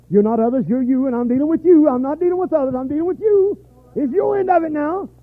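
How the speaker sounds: high, loud, driving sermon voice; fiery preaching. Acted. Preacher to his congregation, loud fiery preaching. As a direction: preach it out at full force.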